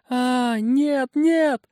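high panicked screaming no; terrified scream. A narrator's reading. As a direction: shriek in terror. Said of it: "Конопатая снова закричала".

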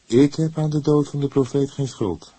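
a serious, earnest denial. A narrator's reading. Young man denies guilt to the cook, earnest. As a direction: say it gravely, meaning it. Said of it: "sprak hij ernstig".

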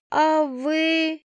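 usual speaking voice

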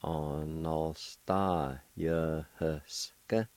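Onostayahuska stretched very slowly; slow syllable demo. Shown as a demonstration. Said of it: "It's pronounced like this".

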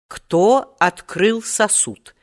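put-on measured businesslike query from an old man; деловитый старичок. A narrator's reading. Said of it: "деловито осведомился тогда старичок". (деловитый вопрос старичка)

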